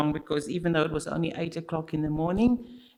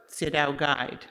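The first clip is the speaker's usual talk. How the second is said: normal talking voice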